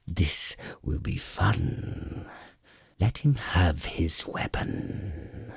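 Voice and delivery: slow, breathy dragon voice savoring the moment; a dragon drawling out its command. Acted. Dragon granting the warrior his weapon, slow and sinister. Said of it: "the dragon commanded".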